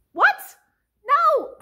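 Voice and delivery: high, loud mock outrage; outraged disbelief. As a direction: burst out in appalled refusal. Outraged reaction to indecisive girl.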